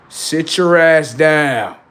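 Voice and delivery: loud, drawn-out order; stern command. Acted. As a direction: order it like a strict parent, stretching every word. Acts out strict self giving orders.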